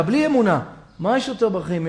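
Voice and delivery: ordinary delivery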